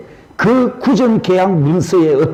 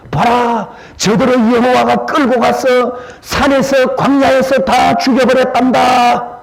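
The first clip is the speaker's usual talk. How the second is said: high, rising pleading voice; impassioned plea; 7 semitones higher than usual. Acted. Moses pleading with God to spare people, high and urgent. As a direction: press the case to God urgently.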